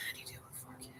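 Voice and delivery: whispered aside; unvoiced wondering whisper. Real, not acted. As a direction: breathe it out, no voice. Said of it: "whispered aside".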